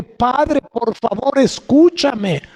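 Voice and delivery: implore, drawing out the last word; clamoring plea. Acted. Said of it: "como el clamor de las manos que".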